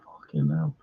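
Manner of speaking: deep low groaned curse, muttered; a low, fed-up curse under the breath; exasperated groan. Real, not acted. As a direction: let it sink out of you in dismay. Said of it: "I'm already frustrated and angered".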